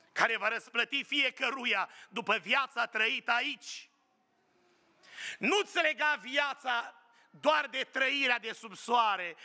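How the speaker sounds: fervent preaching. Acted. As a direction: preach it to the congregation. Preaching run, raised pitch. (predică fervent, cu vocea ușor ridicată)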